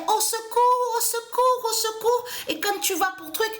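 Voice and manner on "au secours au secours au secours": cris aigus, bien plus hauts et plus forts; appel au secours imité. Retold or imitated. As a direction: imiter la voisine qui hurle de peur. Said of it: "qu'elle se plaignait". (« Au secours » crié tout en haut de la voix)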